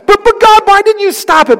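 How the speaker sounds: a high loud plea to God; pleading believer. Pretend. Pastor voicing a doubting listener's plea to God, high and loud. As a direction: cry out the question like a doubter.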